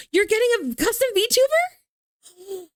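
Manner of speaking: high, slow amazed question; surprised. Real, not acted. Surprised at friend's news.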